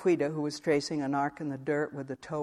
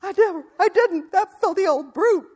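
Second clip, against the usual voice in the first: much higher and louder, crying out through sobs; sobbing denial; 10 dB louder than usual. Acted. Wife sobbing her denial at her shouting husband, shrill and tearful. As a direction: protest desperately through tears. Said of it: "sobbed Missus Dorgan".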